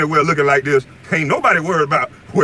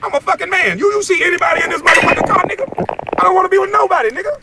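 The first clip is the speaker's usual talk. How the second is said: angry rant; 14 semitones higher than usual. Real, not acted. Calling out a rival from his car, yelling furiously. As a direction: yell it in outrage.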